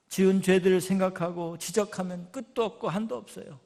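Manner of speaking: loud, driving sermon delivery; forceful preaching. Acted. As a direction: preach it out to the congregation. Sermon application to congregation. (힘 있게 외치는 설교)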